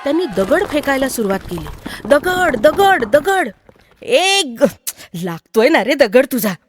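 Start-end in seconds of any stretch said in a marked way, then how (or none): 2.1-3.5 higher, quick-fire repeated shouts; excited playground battle cry; stone-throwing calls. Acted. Children pelting stones, calling out. 4.0-6.5 acted yelp into a complaint; child's mock-pained protest; hurt child protesting. Child hit by stone complains.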